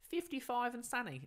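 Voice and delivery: high-pitched Australian voice-assistant impression; imitated Australian assistant. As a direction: chirp it like an Australian smart speaker. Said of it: "Google Home voice is set to Australian".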